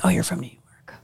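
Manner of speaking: hushed, gossipy mock whisper. Retelling how new neighbors gossiped, in a mock whisper. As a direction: whisper it like a scandal.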